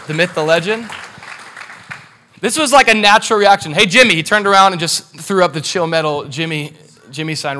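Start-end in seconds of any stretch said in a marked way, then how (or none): 3.7-4.1 raised, louder retold hail; quick high call for attention. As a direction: call to a friend to turn round. Retelling calling out to Jimmy.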